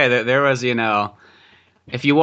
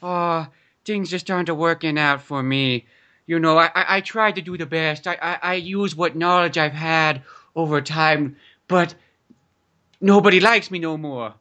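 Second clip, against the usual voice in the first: self-pitying landlord; 2 semitones higher than usual. Pretend. Landlord tells his boy nobody likes him, mock self-pity. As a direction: draw it out as a mock lament. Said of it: "He's just like".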